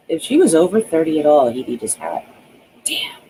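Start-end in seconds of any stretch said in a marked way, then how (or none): 2.8-3.2 whispered. A narrator's reading. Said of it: "he whispered".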